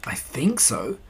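soft breathy whisper. A narrator's reading. Pooh answering Piglet from under the chair, whispered. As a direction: keep it hushed under the chair.

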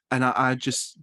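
ordinary talk

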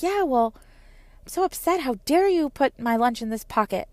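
mildly put-on child's indignation; upset child. Upset daughter complaining to mom about her lunch, mock huffy. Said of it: "it sounds like you're really upset".

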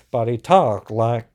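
half-hearted muddled accent imitation; attempted [name] accent. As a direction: have a loud try at an unplaceable crooner accent. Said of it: "I can't even do what he was doing".

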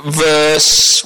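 words stretched out to buy time; drawn-out stalling. Real, not acted. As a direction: hold the vowels while hunting for the reference. Stalling while finding verse number.

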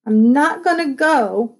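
loud, high tearful protest; crying out. Acted. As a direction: cry it out in protest. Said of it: "she cried".

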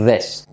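"this" with a buzzing "dh" at the start; Spanish Z made voiced in "this"; voiced initial TH. Shown as a demonstration. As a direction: say "this" as "dhess", voicing the TH. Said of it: "activando las cuerdas".

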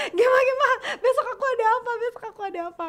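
high, loud, laughing panic, re-enacted; frantic excitement. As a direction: act out a frantic call to your manager. Acts out frantic call to manager.

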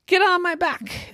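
high, loud order; urgent rescuer. A narrator's reading. Ducky offers the scared duckling a ride, calling urgently. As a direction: call it out to the scared one.

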